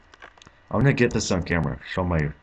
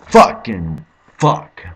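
slow, much louder swearing burst; furious shout; 2 semitones higher, 12 dB louder, much slower than usual. Real, not acted. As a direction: let the anger blow out. Rage at failing again.

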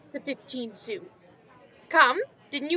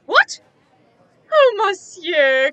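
startled; 5 semitones higher, 7 dB louder, slower than usual. Acted. Innkeeper startled, then fawning.

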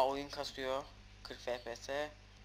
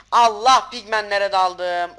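loud alarmed cry, then slower; panicked shout; 4 semitones higher, 15 dB louder, slower than usual. Real, not acted. Gamer yelling to viewers after diving into pigmen. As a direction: yell it as you charge into trouble.

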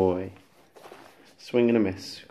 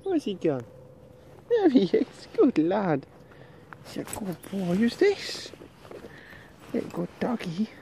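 squeaky praising a dog; 8 semitones higher, 6 dB quieter than usual. Real, not acted. Baby talk to dog.

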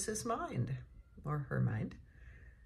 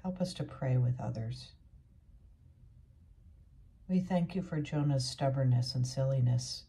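measured, earnest prayer voice; pastoral prayer; 2 semitones lower than usual. Real, not acted. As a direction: pray it out steadily for the congregation. Leads closing prayer.

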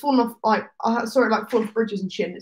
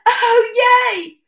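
mock cheer; 10 semitones higher, 5 dB louder than usual. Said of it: "I went".

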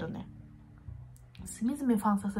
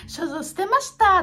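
quoting herself; 9 semitones higher, 8 dB louder than usual. Retold or imitated. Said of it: "って話したり".

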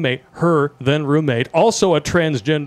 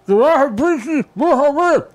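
put-on high, mouth-full accented order; drive-thru order voice; 13 semitones higher than usual. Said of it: "pull into the McDonald's line".

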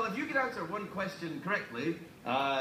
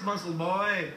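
normal talking voice

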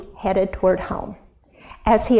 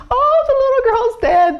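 high, loud mourning wail; 14 semitones higher, 5 dB louder than usual. Acted. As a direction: wail it out in grief. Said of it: "they wailed".

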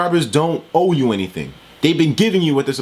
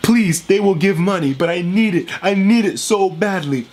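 higher, whiny put-on voice, slowing on the last plea; mock begging YouTuber; 7 semitones higher than usual. Vlogger mocks the YouTuber asking kids for their parents' money. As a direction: beg pathetically to mock him. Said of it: "He made the same video today asking people".

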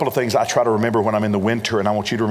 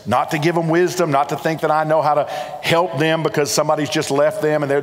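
usual speaking voice

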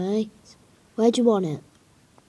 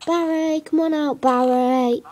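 calling out; 5 semitones higher than usual. Real, not acted. Coaxing Barry the pet out, calling. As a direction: call him out sing-song.

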